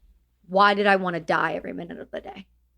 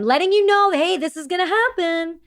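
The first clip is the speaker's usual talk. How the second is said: raised, higher and louder insistence; defiant; 9 semitones higher, 3 dB louder than usual. Retold or imitated. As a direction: push it through like you won't be stopped. Defiant retelling to husband.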